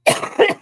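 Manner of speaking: sharp, harsh real cough; real coughing fit. Real, not acted. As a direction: hack out a hard cough. Smoker coughing fit on stream.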